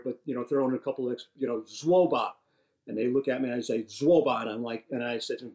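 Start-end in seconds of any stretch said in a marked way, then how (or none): 1.6-2.3 brusque Mandarin brush-off, waving them away; dismissive get out. Retold or imitated. Dismissing the Chinese repairmen at the front door, brusque. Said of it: "saying it that tone is like, "Get out of here". 3.8-4.4 brusque Mandarin brush-off, waving them away; dismissive get out. Retold or imitated. Dismissing the Chinese repairmen at the front door, brusque. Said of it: "saying it that tone is like, "Get out of here".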